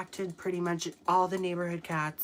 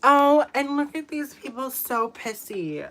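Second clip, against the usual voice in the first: mocking jab; 6 semitones higher, 10 dB louder than usual. Real, not acted. Mocking angry chatters.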